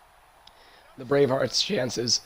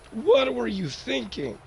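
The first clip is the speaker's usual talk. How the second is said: incredulous; 10 semitones higher than usual. Real, not acted. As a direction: yell it in disbelief at the coach. Disbelief at the fake play.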